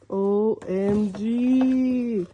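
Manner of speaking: very slow, drawn-out amazement; awed excitement. Real, not acted. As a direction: stretch each letter right out in wonder. Marvelling at the unboxed pan.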